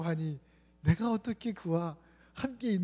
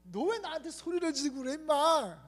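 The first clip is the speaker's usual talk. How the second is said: scolding; 9 semitones higher than usual. Retold or imitated. Pastor scolds shouting young man.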